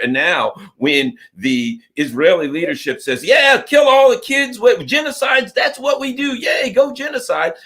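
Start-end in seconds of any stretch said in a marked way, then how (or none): 3.2-7.5 high, shouted mock cheerleading; mock cheering. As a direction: shout it like a rabid cheer, much higher. Mimics Israeli leadership gleefully cheering.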